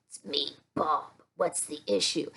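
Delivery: low, soft grumpy grumble; cranky puppet Bob. Acted. Cranky puppet Bob answering the teacher, low grumble. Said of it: "cranky like Bob".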